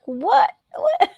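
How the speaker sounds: high-pitched disbelieving "what?"; star-struck double take, voice leaping up; mock astonishment. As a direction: squeak it out in playful shock. Acted amazed reaction to meeting a star.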